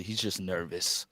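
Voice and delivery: soft, low, slow imitated aside; retold confidential explanation; apologetic aside. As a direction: drop your voice to excuse him. Wife's aside excusing his nerves.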